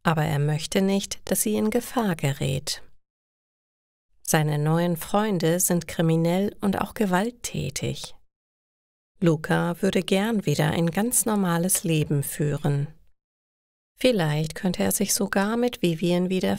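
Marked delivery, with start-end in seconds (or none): none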